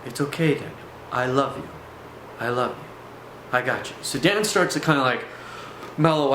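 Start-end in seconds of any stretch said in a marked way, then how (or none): 0.1-3.9 softer, tender reassurance; understated soothing entity voice; reassuring. Retold or imitated. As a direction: comfort a frightened friend gently. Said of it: "He's like".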